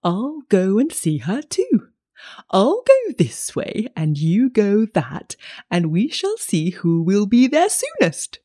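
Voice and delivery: lightly voiced wolf, drawn out; storybook wolf proposing a race, swooping pitch. A narrator's reading. Wolf setting the child a race, drawn-out wolf voice. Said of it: "said the wolf".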